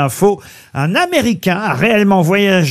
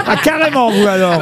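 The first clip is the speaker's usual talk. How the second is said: ordinary talk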